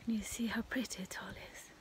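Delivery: hushed whisper so the neighbors won't hear. Real, not acted. Near the neighbors' window, talking to camera in a whisper. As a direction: keep it to a breathy hush, close to the mic. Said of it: "I don't know why I'm whispering".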